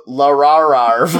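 larararv drawn out slowly with rolled vowel; stretched love. Retold or imitated. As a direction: say larararv long and warbling. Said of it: "you pronounce love larararv".

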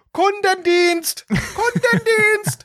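very high, slow, drawn-out shouts; yelling into phone. Retold or imitated. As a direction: scream it at the phone menu. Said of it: "habe ich sofort reingerufen".

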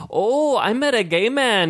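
Scottish lilt, pitched well up and slowed; imitating a friend in a put-on Scottish accent; mock Scottish. Retelling his friend's remark in a put-on Scottish accent. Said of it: "all of a sudden became Scottish".

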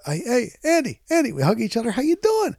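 put-on high, bright hello to an old friend; brother-in-law spots him on the street, excited high greeting. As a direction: call out his name, thrilled to see him.

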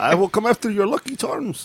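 half-hearted stage Irish threat; bad Irish accent. Cohost teases the host in a weak Irish accent. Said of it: "your Irish is almost as good as".

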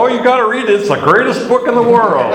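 high, loud mock huckster pitch; hammed-up author plugging his own book; hyped-up sales pitch. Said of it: "when they're trying to go".

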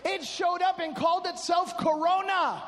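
high, forceful preaching with stretched key words; fired-up preaching. Real, not acted. Preacher warns congregation about covid's spirit, fired-up preaching.

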